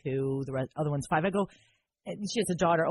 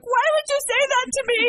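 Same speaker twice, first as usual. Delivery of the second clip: high, loud mock wail; hammed-up whining protest; mock outrage; 5 dB louder than usual. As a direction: protest like you've been wronged. Mock outrage at friend's remark.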